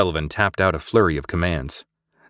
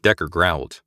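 usual speaking voice

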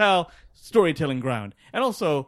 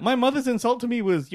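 ordinary talk

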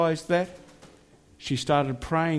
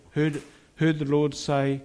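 ordinary talk

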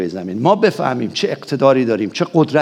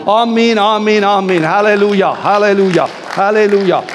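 fervent amen; 3 semitones higher, 3 dB louder, slower than usual. Real, not acted. Congregational amens and hallelujahs.

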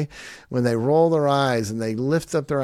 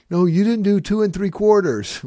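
higher, louder put-on scolding; mock exasperated parent; 7 semitones higher, 5 dB louder than usual. Parent still counting at a stalling kid, mock exasperation. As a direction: imitate a parent losing the counting battle.

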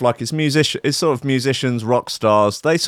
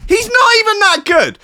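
much higher and louder, half-crying protest; mock outraged whine; 4 dB louder than usual. Real, not acted. Ranting to the listeners about [name], in a high loud mock whine. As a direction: wail that he's not even that good. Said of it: "[name]'s not even that good".

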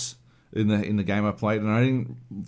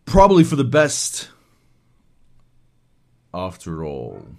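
badly spliced line; 8 dB louder than usual. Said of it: "it sounds like he's supposed to say".

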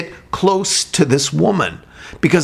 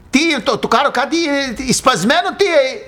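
higher fake Greek gibberish; mock Greek; 10 semitones higher than usual. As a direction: try out some halting Greek on her. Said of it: "maybe she's Greek".

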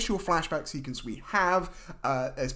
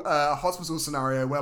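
usual speaking voice

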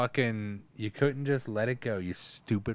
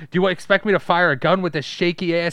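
much higher, louder desperate plea; Eddie Valiant; 9 semitones higher, 8 dB louder than usual. Retold or imitated. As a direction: rant like a shaky drunk detective. Eddie Valiant shaking, begging alcohol.

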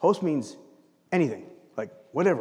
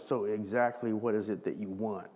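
much softer, leaning back and cool; distancing, skeptical; 6 semitones lower, 9 dB quieter than usual. Retold or imitated. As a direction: hold them at arm's length, quietly. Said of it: "Jesus kind of leans back and goes".

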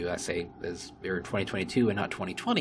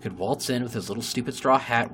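normal talking voice

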